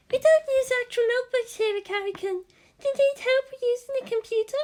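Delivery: a helpful class rep, bright and high; Hikari's voice. Acted. Hikari offering Shinji help with computers, high girlish voice.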